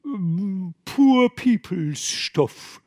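high, drawn-out sheepish fisherman voice; evasive fishermen. Acted. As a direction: stall with a slow dialect drawl. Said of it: "murmelten jene".

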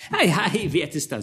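high, reedy courtier pressing his lord; a serpent-tongued adviser, high and insistent; scheming chancellor. A narrator's reading. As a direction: chancellor urging the duke to sign the new law, high and wheedling. Chancellor voice held.

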